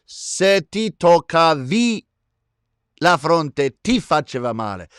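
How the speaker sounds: emphatic, stretched Italian pronunciation; Italian accent. Shown as a demonstration. Host explains the change when "you" touch your forehead, emphatic Italian accent. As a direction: demonstrate the Italian, drawing out "ti toccavi". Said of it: "That's a good Italian accent".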